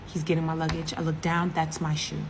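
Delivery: ordinary delivery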